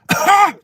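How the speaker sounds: a sharp fake cough, high and loud; demo nervous cough. Shown as a demonstration. Showing a nervous stranger's cough. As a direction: cough nervously before speaking. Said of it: "the first thing they do is cough".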